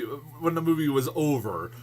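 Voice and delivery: normal talking voice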